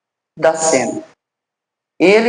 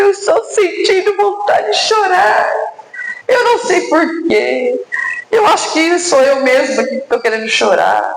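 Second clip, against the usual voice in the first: much higher, tearful and distressed; crying and anguished; 9 semitones higher than usual. Retold or imitated. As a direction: sob it out, confused why the tears come. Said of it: "a irmã que estava chorando e angustiada".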